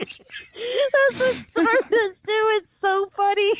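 high, slow words broken by laughter; cracking up while agreeing; laughing. Real, not acted. Laughing at the dog pose story, words breaking up.

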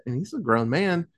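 ordinary delivery

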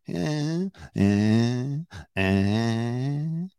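wordless "eh" sliding upward; imitated rising intonation. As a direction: hum out a questioning upward glide. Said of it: "that, the way of".

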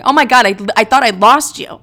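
loud high frantic relief; mock panic. Best friend frantically relieved she woke up, mock panic. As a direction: blurt it out in a panic. Friend shaking her awake.

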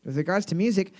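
ordinary talk

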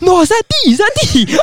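high, loud mock disbelief; imitated alarmed friend. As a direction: cry out as the shocked friend. Friend spots D being dragged.

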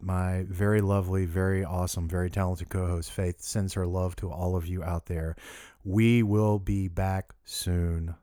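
normal talking voice

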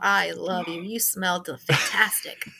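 low, mock-tender adoration; imitated gushing affection. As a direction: coo compliments like a mellow, adoring friend. Imitates stoned friend's compliments.

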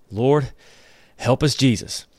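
ordinary talk, nothing marked

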